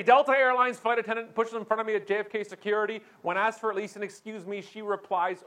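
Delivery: fast, high, breathless-sounding recitation; indignant tweet. Acted. Furious passenger dictating complaint tweet, fast high indignation. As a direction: rattle it off at one high pitch. Reads out his angry tweet.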